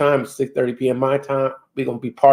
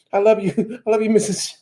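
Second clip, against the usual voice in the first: high, lightly put-on wife voice; a hint of the scolding missus; mock wife; 9 semitones higher, 3 dB quieter than usual. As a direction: tease in a higher voice, breaking into laughter. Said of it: "And my wife".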